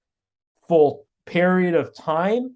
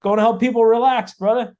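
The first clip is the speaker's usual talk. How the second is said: louder and higher, breezy brag to his son; cheerful quip to son; 6 semitones higher, 5 dB louder than usual. Retold or imitated. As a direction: sing it out to your son, pleased with yourself. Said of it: "I said".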